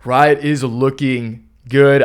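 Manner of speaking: normal talking voice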